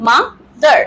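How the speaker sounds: mother as ma, short pause, dar; two distinct beats on mother; split into two syllables. Shown as a demonstration. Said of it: "আমরা দুটো সিলেবেলে উচ্চারণ করব". (mother শব্দটা দুই সিলেবলে আলাদা করে)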